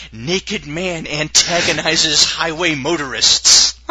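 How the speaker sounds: slow, mock news headline read; headline announcer.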